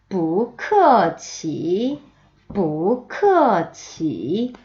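뿌크어치 very slow, ending in a long rise; tone drill. Shown as a demonstration. Teacher modelling the whole phrase, 뿌크어치 slow and stretched. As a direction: model every syllable of 뿌크어치 slowly. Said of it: "전체 문장 볼까요?".

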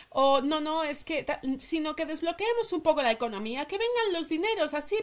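ordinary delivery

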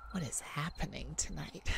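breathless whispered wonder; soft stunned whisper; awed whisper. Acted. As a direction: hush it out in astonishment. Overwhelmed by the gift.

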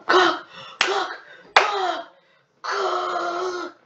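high, loud repeated yells of cook; urging shout. Real, not acted. Gamer yelling at the cooking timer, loud and high.

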